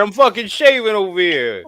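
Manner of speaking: mock Brooklyn tough guy. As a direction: Brooklyn accent hammed up. Mock tough-guy shaving bit.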